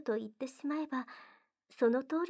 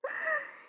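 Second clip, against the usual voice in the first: high, light teasing giggle; a short coy laugh; playful giggle. Acted. Giggle after teasing line.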